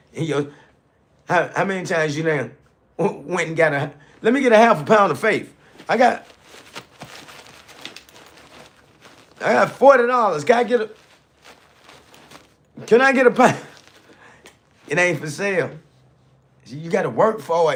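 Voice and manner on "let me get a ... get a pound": high, loud mock shopper voice; mock customer at the counter. Speaker mocks a shopper buying faith at Kroger, hammed up, high and loud. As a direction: ham up an eager customer asking for faith.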